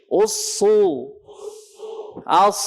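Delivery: "Usu", "As" with heavy, held s; thickened s in "Usu" and "As", spaced for repetition; thick emphatic sad. Shown as a demonstration. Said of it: "Tebal suaranya".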